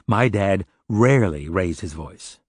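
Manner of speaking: RARELY drawn out slowly and set apart; stress on RARELY. Acted. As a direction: lean hard on the word rarely, taking your time. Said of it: "I like the emphasis on the word rarely".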